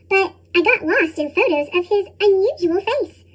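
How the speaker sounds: ordinary delivery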